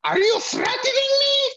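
very high, loud cartoon screech; Cornholio impression. Husband embarrassing his wife on an escalator, Cornholio screech. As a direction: screech it like a manic cartoon character. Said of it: "watch Beavis and Butthead Cornholio".

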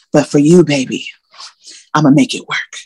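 lightly voiced smooth-talking boyfriend, hammed-up; a hint of a charming player crooning baby; mock sweet-talker. Woman mocking her ex's smooth promise, lightly put-on.

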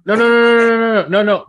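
shouting no over and over; loud protest. Real, not acted. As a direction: cut her off hard. Emphatic loud refusal.

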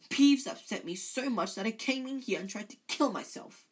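high, loud melodrama; dramatic. A narrator's reading. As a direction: play up the tragic grievance. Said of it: "said Myrtle dramatically".

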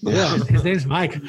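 slow, giggling jab at a friend; laughing tease. Real, not acted. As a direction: tease him while laughing. Teasing, laughing.

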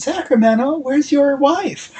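high, slow, lightly voiced flirty woman. Stranger at the bar flirts with Rip in a high, slow voice. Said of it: "woman's like".

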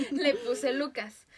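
laughing through the words; giggling it out. Real, not acted. Laughing as she admits the name.

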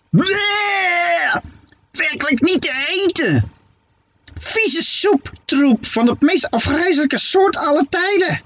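very high, squawking witch voice full of disgust. Acted. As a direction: spit out the words like a gagging old hag. Witch tastes disgusting soup. (zeer hoge, walgende heksenstem)

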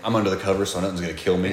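ordinary delivery

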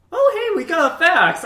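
high, loud, airheaded voice for the clueless office staff; mock clueless staffer. Office staff finally noticing the fax, mock high and loud. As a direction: play the oblivious client all bright and loud.

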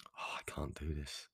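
hushed, slow, near-whispered defeat; defeated self-talk. Acted. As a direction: murmur it like giving up to yourself. Voices a negative inner story.

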